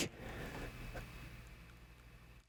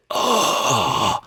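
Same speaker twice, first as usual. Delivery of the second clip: wheezy breath; 2 semitones higher than usual. Retold or imitated. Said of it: "You sound like Darth Vader".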